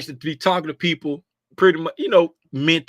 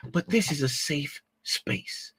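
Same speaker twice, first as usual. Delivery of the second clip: whisper; 10 dB quieter, slower than usual. Real, not acted. Reassuring viewers, whisper.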